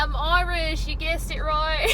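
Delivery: high, loud, put-on Irish accent. Telling guessing girls where the worker is from, Irish accent. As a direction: draw it out in a playful Irish accent. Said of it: "I know it's not my British accent".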